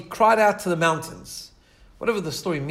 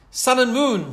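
high, louder wailing cry; 7 semitones higher, 5 dB louder, slower than usual. Acted. Sinner wailing to sun and moon, high and loud. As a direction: call it up to the sky, high and aching. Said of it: "he wailed".

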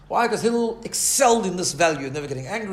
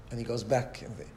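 ordinary delivery

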